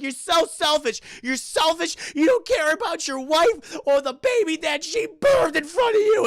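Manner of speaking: high, loud, mock indignant yelling; scolding him for selfishness, loud and high; angry accusing shout. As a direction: rail at him. Accusing Fred in angry outburst.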